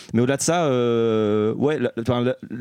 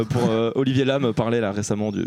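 ordinary delivery

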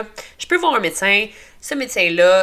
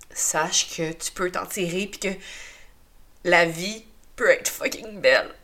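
crying. Real, not acted. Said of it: "recommencer à pleurer comme une madeleine".

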